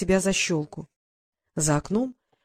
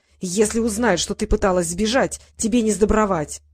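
ordinary delivery

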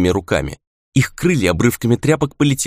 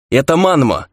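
raised, higher-pitched call; shouted reply; 6 semitones higher, 4 dB louder than usual. A narrator's reading. Ray answering comrades mid-battle, shouted reply. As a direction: call back urgently across the fight. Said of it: "крикнул в ответ Рэй".

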